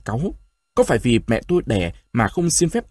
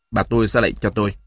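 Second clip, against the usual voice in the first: ordinary delivery, nothing marked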